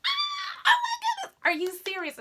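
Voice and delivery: very high, loud delighted scream; surprised shriek. Retold or imitated. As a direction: shriek with shocked joy. Friend's shocked delighted reaction.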